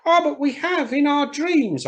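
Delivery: put-on charity children. Charity children leaving the cathedral, high and wondering. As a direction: sing it out like small children in awe. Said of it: "answered the children".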